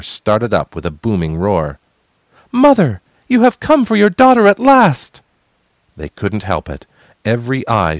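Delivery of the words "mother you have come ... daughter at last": high, loud cry to the sky; triumphant cry. Acted. Tricking the crew into looking up, a high loud cry. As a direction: shout it skyward so every eye follows.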